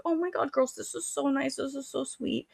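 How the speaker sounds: very high, soft mock-sweet voice; gushing thanks. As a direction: imitate a cooing, touched teacher. Teacher gushing over the card.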